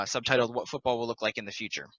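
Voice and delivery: ordinary delivery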